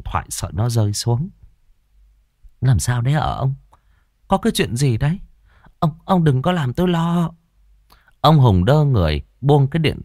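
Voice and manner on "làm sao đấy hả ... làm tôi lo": high, anxious questioning; worried wife. A narrator's reading. Wife asks her stunned husband what happened, worried. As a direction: plead for an answer with rising worry. Vợ lo lắng hỏi chồng.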